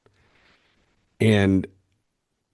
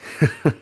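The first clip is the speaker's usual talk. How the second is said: brief, real chuckle in disbelief; quick incredulous laugh; short laugh; 5 semitones higher than usual. Real, not acted. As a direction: let out a quick laugh at what you just read. Laughing at the reply just read.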